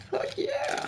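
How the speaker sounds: tiny, very high squeaky cheer; mock cat talk, high and quiet; imagined cat's voice. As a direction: squeak it softly like a delighted cat. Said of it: "The cat's like".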